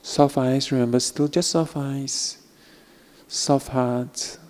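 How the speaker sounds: slow, soft guiding voice with long pauses; guided meditation. Acted. Teacher guiding students through a practice, soft meditation voice. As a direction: ease the listeners into softness, gently.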